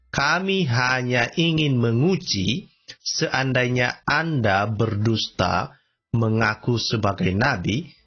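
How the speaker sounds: very slow, word by word; slow deliberate answer. Retold or imitated. Accused answering the prophet's question, slow and deliberate. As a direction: read it out with weighty pauses. Suspects' answer read out slowly.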